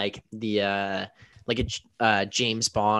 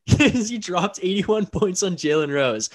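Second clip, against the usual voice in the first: laughing through it, higher and slower; 8 semitones higher, 3 dB louder, slower than usual. Real, not acted. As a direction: giggle as you retell it.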